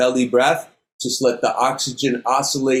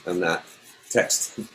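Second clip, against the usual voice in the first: whispered aside; 6 semitones lower, 7 dB quieter than usual. Real, not acted. Aside while checking phone stopwatch.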